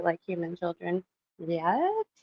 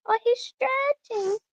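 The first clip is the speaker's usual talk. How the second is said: very high, drawn-out coo over a dog; slower than usual. Real, not acted. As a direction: coo in a tiny high voice, stretching the words. Cooing over the sleeping dog stretching.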